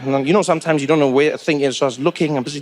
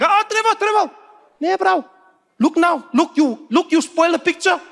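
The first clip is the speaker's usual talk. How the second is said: much higher, fussy telling-off; scolding seatmate; 10 semitones higher than usual. Retold or imitated. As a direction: scold him for spoiling the picture. Seatmate scolding, voiced high.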